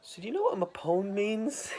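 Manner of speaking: high, put-on questioning voice; high-pitched mock curious ask. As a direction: ask it in a lifted voice. [name] asks about Mapone.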